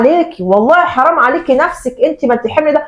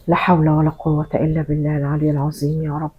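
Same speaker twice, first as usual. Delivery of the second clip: much lower, softer resigned prayer under the breath; weary muttered prayer; 9 semitones lower, 8 dB quieter than usual. Acted. Daughter giving up on the argument, muttering a weary prayer. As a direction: sigh it to God, giving up.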